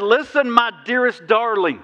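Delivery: earnest loving words, unhurried; sincere reading. Shown as a demonstration. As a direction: read it as Jesus speaking to his bride. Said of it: "Now, watch the next part".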